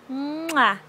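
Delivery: loud smacking kiss; playful mwah for a toddler; kiss sound. Shown as a demonstration. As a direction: show the baby how to blow a kiss. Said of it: "give kisses".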